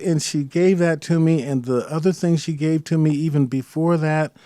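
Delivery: ordinary delivery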